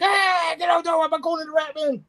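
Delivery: high-pitched mock squeal of protest; squeaky, hammed-up panic; panicking rat. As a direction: play a rat dodging the tongs. Voicing rats fearing the tongs.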